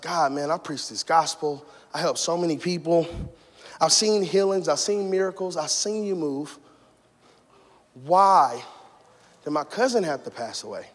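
low, soft questioning of God; hurt, hushed plea for answers; subdued retold lament; grieving prayer.

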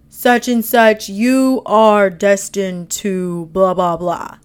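slow, booming voice from above; big dark voice. Acted. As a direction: boom it out slowly, like destiny calling. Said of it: "this big dark voice".

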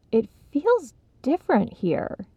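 unsure, tentative; feeling it out, not sure; uncertain. Acted. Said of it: "he announced uncertainly".

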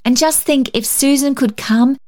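put-on high girlish plea; eager child. A narrator's reading.

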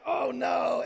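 loud shocked outcry; shocked shout. Retold or imitated. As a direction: yell it as if caught out by the joke. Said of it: "we were like".

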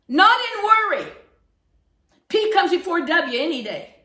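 loud, high-pitched rebuke from the pulpit; fiery preaching. Real, not acted. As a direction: preach it hard, driving up to a peak. Preaching at full force.

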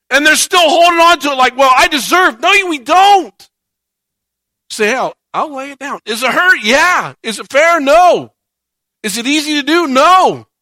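loud, impassioned preaching. Acted. Pastor preaching to his church, impassioned and loud. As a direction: preach it hard to the congregation.

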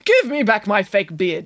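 mildly insistent demand. A narrator's reading. Mister Green wanting his fake beard, demanding. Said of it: "demanded Mister Green".

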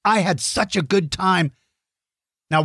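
ordinary delivery

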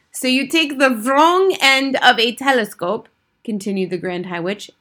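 put-on high, loud accented witch lecture; imperious Grand High Witch. A narrator's reading. Grand High Witch dictating recipe to witches, imperious.

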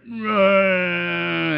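put-on whiny wordless toddler groan; imitated child whine.

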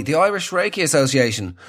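ordinary talk

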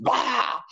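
put-on high-pitched toddler "bah" cry; imitated tantrum scream. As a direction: mimic a screaming toddler in one burst. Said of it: "She screamed at me".

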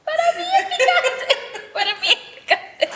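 laughing through the words; giggling while insisting. Real, not acted. Laughing about the spicy food.

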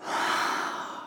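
long, soft exhale; slow breath out as a restful pause; demonstrated sigh. Shown as a demonstration. Showing listeners what Selah is, long soft sigh. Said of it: "which is like a".

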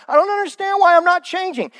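high, mock-whiny complaint; whiny puzzled complaint. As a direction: whine it like a baffled churchgoer. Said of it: "we're like".

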